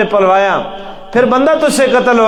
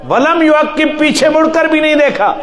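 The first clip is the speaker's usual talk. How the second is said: higher, near-shouted preaching; fervent exclamation; 7 semitones higher than usual. Acted. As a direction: drive the climax home with rising fervour. Preacher climaxes the flight story. (جوشیلی خطابت، بلند آواز میں)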